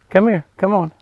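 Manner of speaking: high, short calls to an animal; coaxing an animal over. Real, not acted. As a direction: beckon a shy deer closer. Calling the deer over again.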